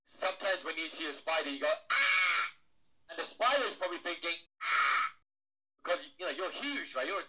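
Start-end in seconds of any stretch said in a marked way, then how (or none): 1.9-2.5 loud, high, breathy mock shriek at a spider; mock scream. Person spotting a spider on stage, mock shriek. 4.6-5.1 mock spider scream.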